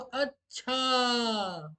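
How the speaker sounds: slow, musing अच्छा drawn out; thoughtful acha. Shown as a demonstration. Teacher shows the PK word said thoughtfully, slow and musing. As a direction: say अच्छा as if weighing it up, slowly. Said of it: "कोई सोच के बोल रहा हो तो".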